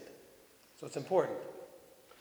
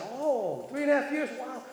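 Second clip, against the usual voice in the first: high, exaggerated amazement; a pastor gently ribbing new believers with fake awe; teasing mock surprise; 6 semitones higher than usual. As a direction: feign being impressed to tease. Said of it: "they laughed... I wasn't teasing".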